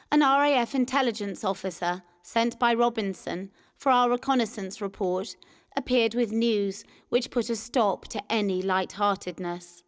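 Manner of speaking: plain reading voice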